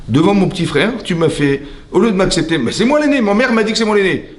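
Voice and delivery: high-pitched protest of a wronged elder brother; Caïn, indignant. Acted. Caïn protests to God at being shamed before his brother, indignant. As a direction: pitch climbing into an aggrieved outburst. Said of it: "Il s'est mis en colère".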